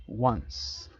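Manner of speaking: "Once" said alone as English "wuns"; pronunciation model. Shown as a demonstration. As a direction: model "Once" with an opening w sound. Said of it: "me encantaría que la pronunciaran".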